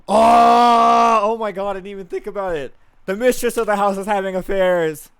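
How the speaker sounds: big gasp then high, loud squeal; shocked shriek. Acted. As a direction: gasp and blurt the scandal out. Gossip realizes mistress's affair.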